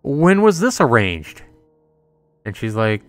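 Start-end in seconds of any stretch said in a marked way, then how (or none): 0.0-1.4 indignant disbelief. Acted. Accused of being the fake.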